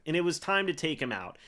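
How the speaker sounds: usual speaking voice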